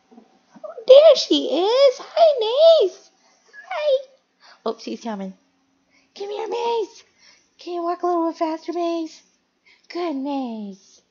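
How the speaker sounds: very high, squeaky cooing to a pet; pet-talk voice. Real, not acted. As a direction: call the kitten over in a high little voice. Calling and cooing to pet Maze.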